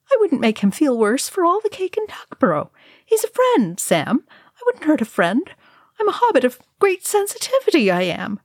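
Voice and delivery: put-on high, eager young hobbit voice; earnest hobbit Pippin. A narrator's reading. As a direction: plead your good heart, a touch wounded. Pippin earnestly defends himself.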